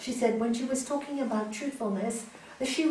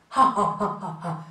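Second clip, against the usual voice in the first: mocking laugh; 4 semitones lower, 6 dB louder than usual. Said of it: "man said".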